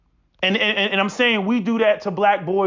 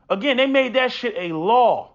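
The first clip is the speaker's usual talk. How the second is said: ordinary talk, nothing marked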